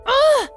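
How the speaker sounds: high exasperated "ugh"; short, high cry of exasperation; frustrated groan. Acted. Daughter sent to her room.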